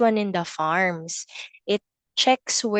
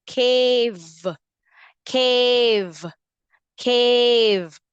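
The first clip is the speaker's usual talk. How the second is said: put-on teacher stressing the V in "Cave" for kids; emphasized modeled word; 3 semitones higher, 4 dB louder, much slower than usual. Shown as a demonstration. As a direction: lean on the V of "Cave" for the class. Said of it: "We have the cave".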